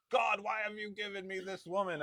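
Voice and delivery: high, pleading mock lament; mock exasperated plea. Frustrated husband crying out to God about his wife, mock exasperation. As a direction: wail a hammed-up complaint to heaven.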